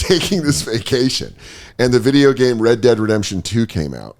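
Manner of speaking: normal talking voice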